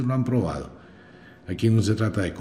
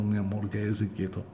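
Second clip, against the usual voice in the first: softer, hushed embarrassed pleading; embarrassed mother; 7 dB quieter than usual. Retold or imitated. Mother in a doctor's office hushing her hitting child, embarrassed and soft. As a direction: quietly beg the child to sit still, ashamed. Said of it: "la mamá toda avergonzada".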